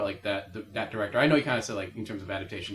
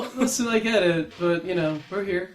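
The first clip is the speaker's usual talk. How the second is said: mock American wizard; 11 semitones higher, 7 dB louder than usual. Voicing the snubbed American wizards.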